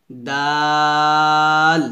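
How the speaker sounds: দাল with a light D and long, level "aa"; long-drawn, thin দাল; thin soft D. Shown as a demonstration. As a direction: stretch দাল out evenly. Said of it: "তা, দাল পাতলা করে উচ্চারণ করতে হবে".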